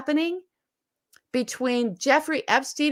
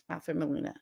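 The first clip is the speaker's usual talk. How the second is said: normal talking voice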